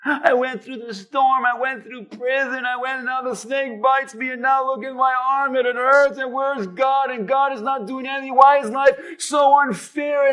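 run-on woe-is-me complaint, swelling as it goes; self-pitying. Acted. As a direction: pile up grievances like a hammed-up sufferer. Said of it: "would have the best pity party".

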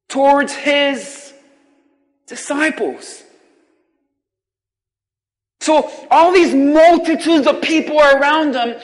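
loud, slow, soaring preacher voice; fervent pulpit preaching; preaching at full force. Acted. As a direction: proclaim each blessing to the hall. Preaches the Beatitudes.

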